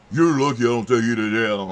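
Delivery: loud threatening cop voice; retold officer growling a parting threat, loud. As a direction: throw the threat at him, loud.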